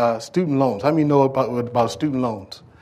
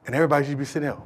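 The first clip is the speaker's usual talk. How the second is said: ordinary talk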